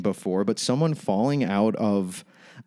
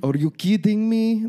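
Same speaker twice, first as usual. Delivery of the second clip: higher, slow try at Elena's accent; Elena's accent attempt; 12 semitones higher, 3 dB louder than usual. Shown as a demonstration. Podcast host tries cohost's requested line in Elena's accent. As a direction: stretch it out in Elena's accent, overshooting. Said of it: "trying to do her accent".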